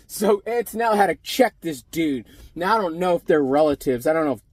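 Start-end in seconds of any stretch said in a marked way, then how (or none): none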